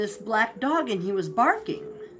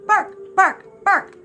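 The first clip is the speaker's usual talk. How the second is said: loud, high "bark" in short bursts; imitated dog bark; 9 semitones higher, 6 dB louder, slower than usual. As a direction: say the word as a dog barks. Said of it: "while the dog was bark".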